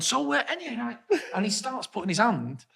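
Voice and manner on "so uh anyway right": higher, casual mock voice; breezy bloke changing topic; imitating Will. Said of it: "he was going".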